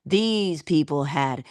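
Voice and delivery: ordinary talk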